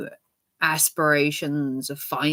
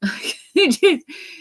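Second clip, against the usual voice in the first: high laugh; 10 semitones higher, 4 dB louder than usual. Real, not acted. Laughs after imagined quote.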